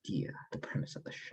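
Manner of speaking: whispered aside; whispering. Real, not acted. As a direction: whisper the prompt to your co-host. Aside prompting co-host.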